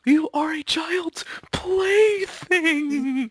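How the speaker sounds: very high, very slow whispered cry; cartoon villain taunt. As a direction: draw out the insult like a cartoon villain. Acting out cartoon quarrel.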